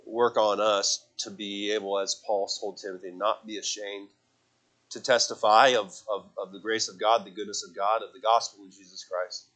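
soft, hushed opening prayer; quiet prayer. Acted. Pastor praying over teens and church, soft prayer voice. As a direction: pray it gently over the congregation.

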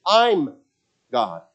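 "I'm God" with the peak on I'm; stress on I'm. Shown as a demonstration. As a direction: proclaim it, weight all on I'm. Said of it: "emphasis on the word "I".

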